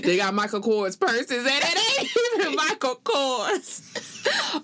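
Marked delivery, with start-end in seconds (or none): 1.5-4.6 laughing. Real, not acted. Laughing about fake purses.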